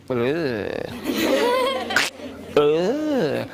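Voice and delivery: drawn-out "ugh"; hammed-up noise of disgust; disgusted groan. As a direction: groan as if stepping in slime. Stepping into sticky mud.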